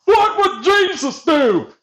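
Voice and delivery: hammed-up churchy voice; mock pious. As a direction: parody a self-righteous believer. Mocking pious voice.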